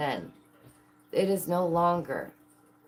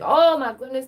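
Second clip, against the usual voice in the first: higher and louder gasp of mock surprise; mock amazement; 7 semitones higher, 9 dB louder than usual. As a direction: exclaim it like a stunned reporter. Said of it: "they were like".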